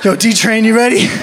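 pumped-up call to himself, choo-choo at the end; self hype voice. Retold or imitated. Teen preacher hyping himself up, pumped call. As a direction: psych yourself up like a train. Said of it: "nickname for myself".

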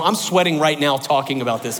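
usual speaking voice

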